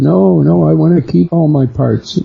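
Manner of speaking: put-on panicked child's plea. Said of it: "I started crying".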